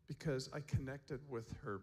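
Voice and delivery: ordinary talk, nothing marked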